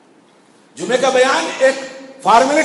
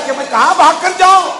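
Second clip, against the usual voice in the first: preacher acting out a desperate call; raised-voice plea of someone seeking escape; crying out; 4 semitones higher, 5 dB louder than usual. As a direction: call out the question, building to the end. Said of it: "جب وہ پکار کر کہے گا".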